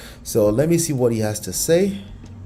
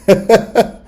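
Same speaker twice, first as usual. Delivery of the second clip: loud laughter; 4 semitones higher, 8 dB louder than usual. Real, not acted. Laughing loudly.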